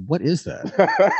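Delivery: high, drawn-out "what is that"; retold astonishment. Friend hearing the accent for the first time, astonished. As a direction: retell someone stopped short in amazement. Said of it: "he stopped in his tracks and said".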